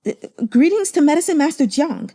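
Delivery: high, hesitant awed greeting; flustered cultivators greeting the master; nervous greeting. Acted. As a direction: stammer it out in awe.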